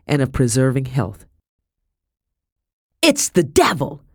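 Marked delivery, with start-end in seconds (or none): 3.0-4.0 high, alarmed cry; high-pitched mild scream. A narrator's reading. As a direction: cry out high in horror, short of a full scream. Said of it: "Madam screamed".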